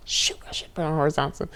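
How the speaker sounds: fast hushed whisper to herself; whispered aside. Real, not acted. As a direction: mutter it off-mic. Aside about the phone.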